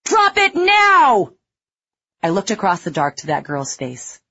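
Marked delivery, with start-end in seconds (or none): 0.0-1.3 very loud, high, slow scream; screamed order. A narrator's reading. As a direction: scream the last warning. Marine's final warning.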